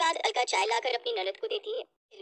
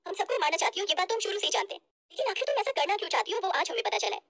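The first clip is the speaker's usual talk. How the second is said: fast, high, indignant tirade; angry accusation; 4 semitones higher, faster than usual. Acted. Sister-in-law exposing bhabhi before mother and brother, angrily. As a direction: denounce her before the family.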